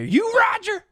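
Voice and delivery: high-pitched shouted demand; acted irate challenge; angry confrontation. Confronting a cameraman.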